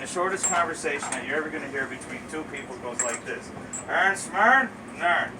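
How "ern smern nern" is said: thick, raised-pitch Newfoundland mumble with a rising question and short reply; Newfoundland dialect. Shown as a demonstration. Show the audience the shortest Newfoundland conversation, in thick dialect. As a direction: greet and answer in dense Newfoundland dialect. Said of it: "idea of our dialect here in Newfoundland".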